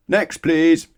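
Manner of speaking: high, loud brusque call; loud dismissal. Acted. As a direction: wave the next customer on loudly. Grocer dismissing.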